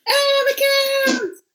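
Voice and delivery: high-pitched hammed-up amazement; play-acted shouting about a wondrous sight; mock shouting. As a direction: cry out like a gushing idiot, drawing out the words. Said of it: "but you're shouting".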